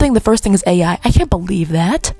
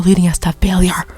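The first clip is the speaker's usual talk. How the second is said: usual speaking voice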